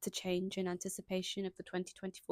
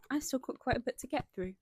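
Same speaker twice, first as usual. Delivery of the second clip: usual speaking voice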